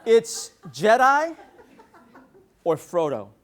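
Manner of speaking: slow, rising first option, dropping on the second; deadpan husband presenting an ultimatum; mock-solemn husband offering choice. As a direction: lay out two options with deliberate pauses. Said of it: "So I said".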